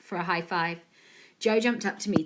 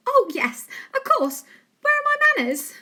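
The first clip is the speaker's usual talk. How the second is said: cheery mum voice; 7 dB louder than usual. A narrator's reading. Mum's voice again.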